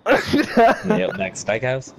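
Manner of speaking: loud, slow mock Australian; Australian accent. Friend showing how it goes, in loud mock Australian accent. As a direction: draw it out in a big Australian accent. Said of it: "talk like them".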